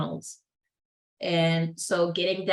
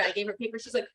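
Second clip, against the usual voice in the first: ordinary talk, nothing marked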